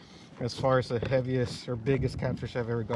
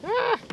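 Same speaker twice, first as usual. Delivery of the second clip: startled squeal, very high; sudden high yelp. Real, not acted. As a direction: yelp at the bite. Fish strike, sudden cry.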